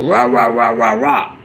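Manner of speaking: low, monotone rah-rah-rah; deep mock-rant babble; mock ranting noise. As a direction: imitate going off on someone in a low growly babble. Imitates going off on someone.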